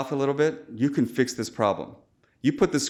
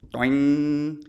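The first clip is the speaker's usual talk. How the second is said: a quick, louder, higher vocal twang; imitated twang; 4 semitones higher, 4 dB louder than usual. Shown as a demonstration. Surgeon mimicking the flicked needle's sound, imitated twang. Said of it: "you can take the top and you can flick it".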